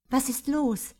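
a touch of irritation at her distracted friend; slightly irritated. A narrator's reading. Friend asks why she is distracted, slightly irritated. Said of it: "fragte Tina leicht irritiert".

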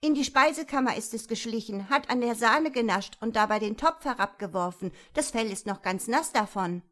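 loud, fast tirade; quick, heated telling-off; angry scolding. A narrator's reading. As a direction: rattle off the complaint indignantly. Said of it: "zankte Marie".

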